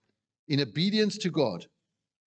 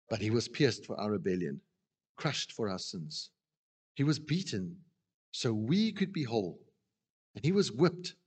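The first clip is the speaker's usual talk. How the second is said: solemn scripture reading; 3 semitones lower, 4 dB quieter than usual. Acted. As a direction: read the prophecy gravely and tenderly. Reads Isaiah to close.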